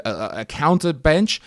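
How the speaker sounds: ordinary talk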